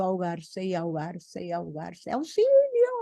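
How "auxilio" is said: high squeaky cry of a drowning cricket; cricket crying for help. Acted. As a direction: shriek for help in a tiny high voice. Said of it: "gritaba la grilla".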